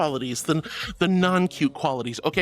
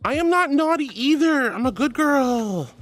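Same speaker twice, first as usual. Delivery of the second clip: higher, pouty self-defence; whiny, pitched-up objection to teasing; mock indignant protest; 5 semitones higher than usual. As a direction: protest your innocence playfully. Protest at being called naughty.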